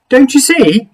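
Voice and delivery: high, loud pleading cry; creature cries out. Acted. Creature cries out to Something Else, high and pleading. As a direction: urge him to see it. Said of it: "the creature cried".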